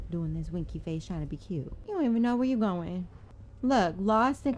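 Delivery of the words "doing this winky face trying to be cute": low, soft mocking aside; mocking mutter. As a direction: sneer it quietly. Mocking the monster.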